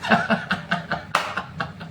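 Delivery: breathy laugh at a joke. Real, not acted. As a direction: let out a short laugh. Mocking laugh after comedian jibe.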